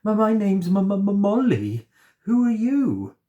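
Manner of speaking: high, loud, stammering in fear; frightened stammering girl. A narrator's reading. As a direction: stutter your name, terrified. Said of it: "she said".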